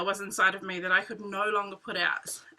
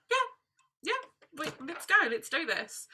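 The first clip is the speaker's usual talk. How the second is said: higher, quick eager agreement; husband eager; 5 semitones higher, 3 dB quieter, slower than usual. Retold or imitated. Husband eagerly agreeing to list the bike, higher and keen. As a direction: jump at it, no hesitation.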